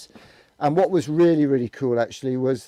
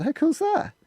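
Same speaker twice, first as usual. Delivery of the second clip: excited; slower than usual. Real, not acted. Showing off his equipment.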